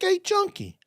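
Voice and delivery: high put-on voice; mock call. Imitating the guy greeting him at the truck, high mock voice. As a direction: ham up the guy calling out. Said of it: "He like".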